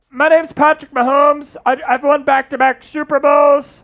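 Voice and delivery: high, strained put-on quarterback voice; high-pitched caricature that hurts the throat; Patrick Mahomes. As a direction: squeeze the voice up high for an athlete impression. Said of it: "Patrick Mahomes, that's tough".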